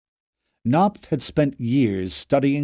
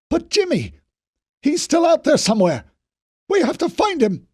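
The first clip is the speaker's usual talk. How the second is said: desperate shouting plea; 3 dB louder than usual. A narrator's reading. Knopf pleading for Jimmy.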